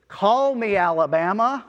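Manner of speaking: loud, high toddler attempt at an Alabama accent; thick Alabama accent. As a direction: holler it like a little kid with an Alabama twang. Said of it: "with a really thick Alabama accent".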